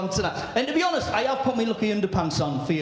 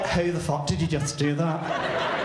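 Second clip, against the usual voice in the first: lower, slow camp monster voice; camp Loch Ness Monster; 5 semitones lower, slower than usual. Retold or imitated. Loch Ness Monster gasps at the wife, low slow camp voice. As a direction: drawl it like a shocked camp monster. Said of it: "He's like".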